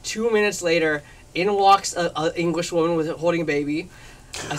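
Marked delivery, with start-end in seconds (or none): none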